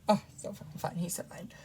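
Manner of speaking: hushed whispered aside. Real, not acted. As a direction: breathe it under your breath. Aside while parents' movie plays.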